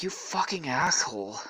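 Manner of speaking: plain reading voice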